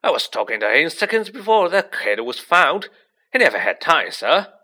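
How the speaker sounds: loud, insistent protest with big pitch swings; frantic, booming defence; agitated ranting. A narrator's reading. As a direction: rant on urgently at full voice. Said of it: "Hagrid went ranting on".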